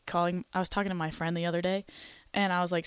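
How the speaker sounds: ordinary delivery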